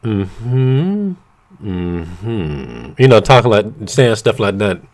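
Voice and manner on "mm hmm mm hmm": low seductive mm-hmm imitation; sexy mm-hmm. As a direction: hum it low and sultry. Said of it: "Trying to say it all sexy".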